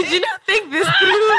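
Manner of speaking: teasing through laughter; laughing. Real, not acted. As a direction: laugh it out, half crying.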